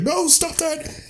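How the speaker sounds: very high, slow Python falsetto; squeaky prince voice. Retold or imitated. The prince telling the guests he is alive, high falsetto. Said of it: "He's like".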